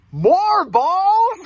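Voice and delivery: high, loud incredulous question; snake character. Acted. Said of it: "And the snake says".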